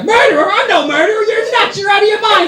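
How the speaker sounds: high, loud mock shouting; yelling back. Husband yelling back at the answering machine, hammed-up. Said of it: "I actually argue back".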